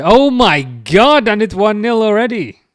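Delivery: loud, high cry of disbelief at the goal; yelling at the screen as the goal goes in; dismayed outburst. Real, not acted. Fan watching the match cries out in dismay.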